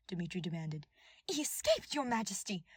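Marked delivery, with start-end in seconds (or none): 1.3-2.6 high, louder frantic call; frazzled. A narrator's reading. Cornelia alarming the king, frazzled high call. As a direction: blurt it out in a panic. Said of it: "Cornelia's frazzled voice rang out".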